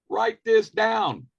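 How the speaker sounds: ordinary delivery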